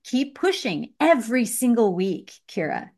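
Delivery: normal talking voice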